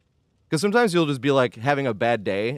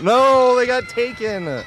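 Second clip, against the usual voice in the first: high, slow whine, louder on no; whiny voice; 11 semitones higher, 4 dB louder, much slower than usual. Real, not acted. Watching teammates get taken, a real high whine. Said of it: "You see how that whiny voice came out".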